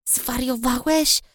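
slow, hissed anger; angry hiss. A narrator's reading. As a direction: hiss at him in fury. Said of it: "syknęłam ze złością".

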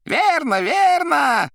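very high, loud exclamation; Koroviev crying out. A narrator's reading. Koroviev agreeing with Margarita, high excited cry. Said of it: "кричал Коровьев".

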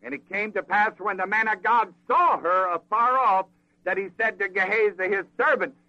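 ordinary talk, nothing marked

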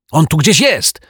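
high, loud excited yell; boy yelling. A narrator's reading. Little boy on the beach yelling to the detective, high and loud. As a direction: shout it out like a startled kid. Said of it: "wrzasnął chłopiec".

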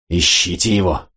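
ordinary delivery, nothing marked

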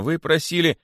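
high, timid excuse; frightened. A narrator's reading. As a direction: start explaining fearfully. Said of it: "начал было находчивый испуганно". (испуганно)

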